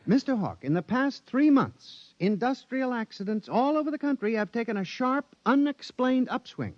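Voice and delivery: pitched high, pressing urgency; an anxious statistician urging his boss to listen; urgent alarm. Acted. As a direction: push the warning hard and high.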